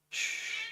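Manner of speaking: soft, long hush; hushing shh. Real, not acted. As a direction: shush the room softly. Hushing over a crying baby.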